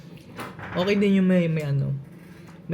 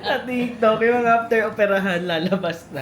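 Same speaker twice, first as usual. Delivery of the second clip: laughing; 7 semitones higher, 4 dB louder than usual. Real, not acted. Laughing while telling.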